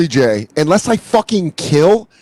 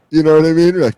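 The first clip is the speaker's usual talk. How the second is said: normal talking voice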